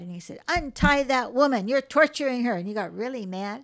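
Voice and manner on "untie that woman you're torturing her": loud, higher outraged command; angry shout. Retold or imitated. Psychologist yelling at the men to free her, angry shout. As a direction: bark it like an alarmed bystander. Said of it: "He got mad".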